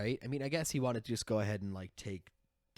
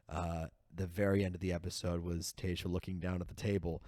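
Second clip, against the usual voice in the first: normal talking voice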